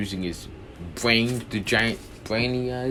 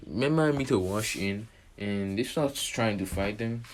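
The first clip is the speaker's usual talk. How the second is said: ordinary delivery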